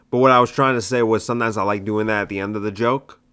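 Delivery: ordinary talk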